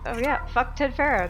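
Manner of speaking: ordinary talk, nothing marked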